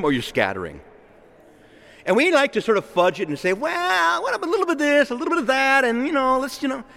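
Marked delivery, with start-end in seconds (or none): 3.5-6.8 much higher, hedging mock voice of someone bargaining; mock wheedling voice. Preacher mocking his congregation's hedging, in a high wheedling voice. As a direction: play the dodger who wants a bit of both.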